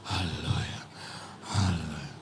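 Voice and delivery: low, soft, spent hallelujah; re-enacted weary low murmur; hushed hallelujah. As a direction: drop right down, almost to yourself. Winded preacher's hushed reaction in story.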